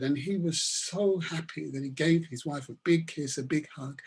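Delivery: ordinary talk, nothing marked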